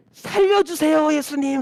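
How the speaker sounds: loud, high, drawn-out plea for rescue; crying out. Acted. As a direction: shout it out like a desperate beggar. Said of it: "소리 지르는 거야".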